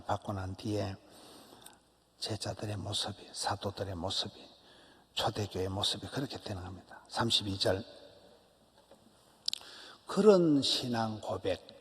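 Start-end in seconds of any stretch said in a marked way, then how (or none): none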